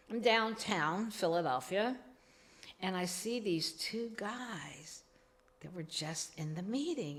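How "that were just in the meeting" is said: ordinary talk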